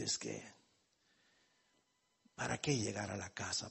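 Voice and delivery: low, soft and worn-out retelling; weary complaint. Retold or imitated. Husband at the petrol station telling the pastor, softly weary. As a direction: sigh it out like a man sick of the nagging.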